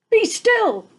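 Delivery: high, loud sharp command; commanding Max. Acted. Max ordering the wild things to be still. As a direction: stop the monsters with one order. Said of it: "Till Max said".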